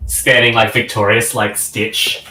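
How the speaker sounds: normal talking voice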